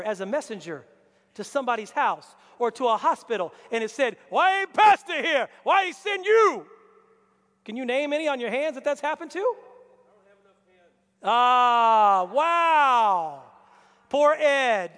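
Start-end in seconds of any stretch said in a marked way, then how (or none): 4.3-6.7 higher, louder complaining yell; indignant shout. Retold or imitated. Family yelling at the pastor's messenger, indignant shout. 11.2-13.4 louder, drawn-out astonishment; loud amazed wow. Real, not acted. Preacher seeing raised hands, loud amazement.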